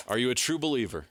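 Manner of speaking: usual speaking voice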